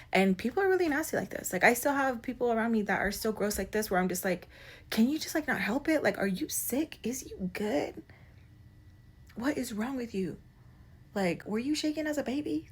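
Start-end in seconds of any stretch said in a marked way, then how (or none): 4.9-12.7 high, hushed disbelief; incredulous rebuke. Acted. Asking people who act gross what's wrong, incredulous. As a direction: question them softly in a raised pitch, incredulous.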